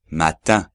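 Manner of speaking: matin with a nasal ending, no n sound; French matin. Shown as a demonstration. Teacher models French matin for students. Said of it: "lo repito".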